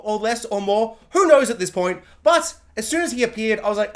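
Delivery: usual speaking voice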